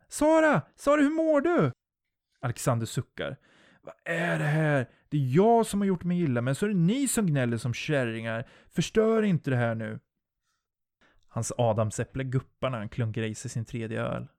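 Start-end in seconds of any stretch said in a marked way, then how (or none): 0.1-1.7 very high, loud, desperate cry; alarmed calling. A narrator's reading. Jennifer shakes injured Sara, calling. 3.8-10.0 exasperated, stretched-out complaint; irritated scolding. A narrator's reading. Alexander scolds whining friends.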